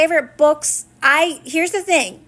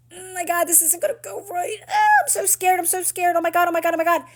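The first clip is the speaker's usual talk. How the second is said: hammed-up frightened whimper, pitched higher; mock panic; 6 semitones higher, faster than usual. Coach imitating a listener who panics over outcomes, mock fearful whine. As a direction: play the scared worrier, breathless and rising.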